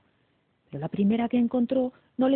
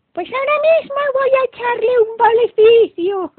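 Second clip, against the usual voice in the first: very high, loud wicked witch voice; shrill witchy menace, full blast; snarling evil fairy; 12 dB louder than usual. A narrator's reading. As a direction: threaten it like a cackling villain. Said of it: "gruñó el hada mala".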